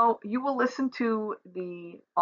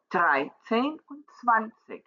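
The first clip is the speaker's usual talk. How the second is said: dreizehn und zwanzig, slow, each first syllable hit hard: DREI-zehn, ZWAN-zig; stress on first syllable; slower than usual. Shown as a demonstration. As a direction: lean on the front of dreizehn and zwanzig. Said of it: "with the first syllable being the louder".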